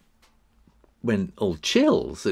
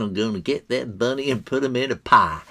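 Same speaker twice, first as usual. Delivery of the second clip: light cartoon hunter impression, slightly put-on; Elmer Fudd voice; 3 semitones higher, 4 dB louder than usual. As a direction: playfully mimic the cartoon hunter. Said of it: "Elmer Fudd with his big gun".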